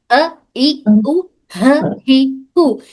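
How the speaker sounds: अ, ई, उ said slowly from the lowest part of the throat; throat letter demo. Shown as a demonstration. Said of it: "बिल्कुल हलक के निचले हिस्से से".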